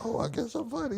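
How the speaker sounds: small, high, soft voice; sheepish acceptance. Retold or imitated. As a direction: concede it shyly, high and quiet. Modest reply to surprise praise.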